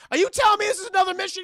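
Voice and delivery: loud, high, fast disbelief; indignant outburst. Retold or imitated. Carter to his partner about another mission, indignant outburst. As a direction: explode at your partner in one rapid rush. Acted quarrel between the film partners.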